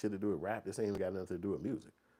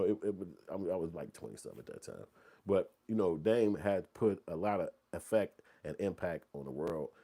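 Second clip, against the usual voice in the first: ordinary delivery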